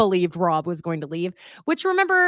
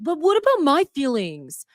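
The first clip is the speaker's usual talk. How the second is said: mock whiny Rob; 7 semitones higher than usual. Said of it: "gets all emotional and angry".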